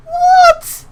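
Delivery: high startled shriek; shocked squeak. Acted. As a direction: yelp it out in disbelief. Character shocked.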